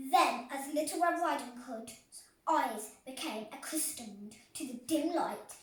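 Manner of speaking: ordinary delivery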